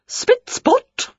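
high-pitched magical command; two quick words, pitched right up. A narrator's reading. Aunt waves her wand to tidy, high command. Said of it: "she commanded".

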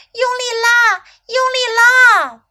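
high, loud rallying shout. A narrator's reading. Snake urging little elephant to pull, shouting. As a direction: shout the repeated command to spur someone on. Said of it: "蛇大喊道".

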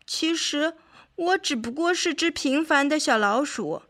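a touch of acted weeping; mildly tearful. A narrator's reading. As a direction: plead as if close to tears. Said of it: "哭泣着说道".

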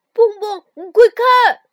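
loud high alarmed shout. Acted. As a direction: yell out in a panic. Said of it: "跳跳忽然大叫一声".

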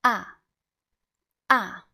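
short, loud "Ah" dropping from high; emphatic, sharply falling "Ah"; slightly aggressive fourth tone. Shown as a demonstration. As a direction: throw "Ah" down firmly. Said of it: "a little bit aggressive".

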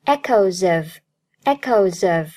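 "echoes of" run together as "echo-zuv"; linked pronunciation. Shown as a demonstration. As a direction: carry the z sound of "echoes" into "of". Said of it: "echoes和of连读".

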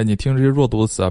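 ordinary talk

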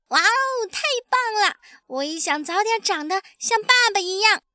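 put-on very high child's wonder; thrilled baby dinosaur. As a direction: gush in awe of daddy. Baby admires father's skill. (小恐龙兴奋崇拜地惊叹)

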